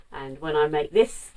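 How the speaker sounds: ordinary delivery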